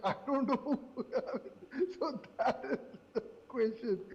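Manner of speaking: talking through laughter; real laughing. Real, not acted. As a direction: let the laughter break up the words. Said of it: "I apologize for laughing".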